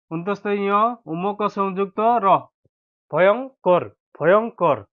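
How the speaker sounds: ordinary talk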